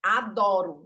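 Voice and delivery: "adoro" punched out loud syllable by syllable; syllables marked. Shown as a demonstration. Teacher demonstrates the marked word, loud and marked. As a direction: hit each syllable of "adoro" hard. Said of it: "eu marquei as sílabas da palavra adoro".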